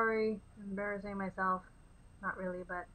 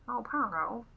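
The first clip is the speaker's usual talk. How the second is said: a hint of an old movie cartoon dog, louder; 2 semitones higher, 8 dB louder, slower than usual. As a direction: lightly voice the dog from the old film. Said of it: "One of the dogs actually talks like that".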